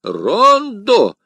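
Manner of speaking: high, loud automatic repeat; the curé echoing blankly; mechanical echo. A narrator's reading. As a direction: parrot it back unthinking. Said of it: "машинально повторил Кюре".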